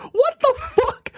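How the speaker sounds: high, slow fake wail; mock cry. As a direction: wail it like a hammed-up sob. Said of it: "mock wail at 1840".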